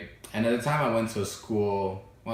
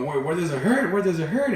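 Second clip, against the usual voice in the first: higher-pitched, urgent worried-mother questioning; anxious mom; 7 semitones higher than usual. Retold or imitated. Mom asks crying child where it hurts, anxious. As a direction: imitate a fretting mom.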